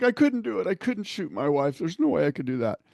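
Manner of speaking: high, pleading joke-telling cry, lightly done; mock-tearful candidate saying he couldn't do it; crying man. A narrator's reading. Candidate in the joke tells the agent he couldn't do it, mock crying. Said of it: "he's crying".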